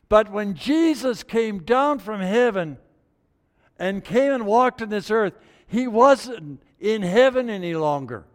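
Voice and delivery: high, loud, driving sermon; preaching at full force. Acted. Preacher proclaiming Jesus came down to earth. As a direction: drive it home to the congregation.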